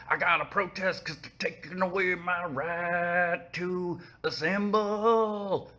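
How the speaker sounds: high, slow whiny protester voice; mocking idiot protester.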